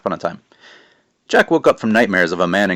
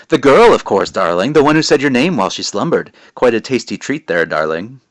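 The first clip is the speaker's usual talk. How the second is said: louder, higher, gloating witch; creepy witch voice; 4 semitones higher, 7 dB louder than usual. Acted. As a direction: savor each word like a witch eyeing dinner. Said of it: "Creepy voice, isn't it?".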